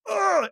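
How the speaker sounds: high, loud shove grunt; effort grunt. Retold or imitated. Shoving the father-in-law across the garage, a high effort grunt. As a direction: grunt as you push hard.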